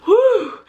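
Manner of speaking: high, loud breathless whew; out of breath whew. Retold or imitated. Pregnant vlogger winded after dashing up the stairs, loud high breathless whew. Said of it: "I'll be like".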